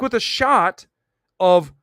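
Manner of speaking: ordinary talk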